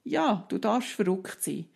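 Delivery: put-on high, gently validating voice to a child; understanding parent. Shown as a demonstration. Mother showing how to calm a child who is upset about gummy bears, high and understanding. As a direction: meet the upset child where it is. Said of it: "was wäre die adäquate".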